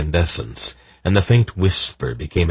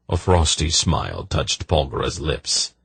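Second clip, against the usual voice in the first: ordinary delivery, nothing marked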